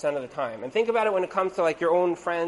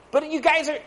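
high-pitched mock objection; insistent complaint; 9 semitones higher, 3 dB louder than usual. Client still complaining after the apology, high insistent protest. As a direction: press on in a raised, protesting pitch.

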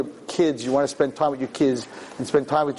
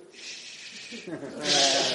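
long hushing "shh"; library shushing; 8 dB quieter than usual. Acted. Preacher mimics students in a college library, shushing. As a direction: hush noisy readers with a drawn-out shh.

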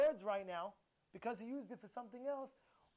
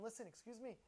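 quiet, courteous retold remonstrance; nice tone; 2 semitones lower, 12 dB quieter than usual. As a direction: gently get their attention. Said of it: "in a nice voice, in a nice tone".

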